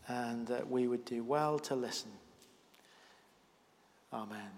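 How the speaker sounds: soft, hushed opening prayer. Real, not acted. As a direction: pray it quietly, ending on a soft amen.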